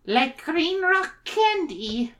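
put-on high, slow girlish voice; girl character. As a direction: pitch it way up and stretch it out. Reader voices girl Mary.

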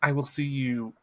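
high, swooping endearments; affectionate, theatrical Abner. Acted. Abner bidding the lemon tart farewell, high and theatrical.

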